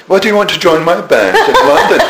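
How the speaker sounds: quoting the offer with a laugh in it; laughing through the quote. Retold or imitated. Retelling the bandleader's surprise invitation, laughing.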